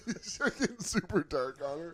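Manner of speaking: usual speaking voice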